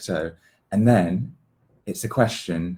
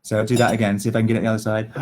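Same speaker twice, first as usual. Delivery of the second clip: ordinary talk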